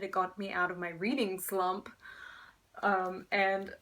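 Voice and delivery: normal talking voice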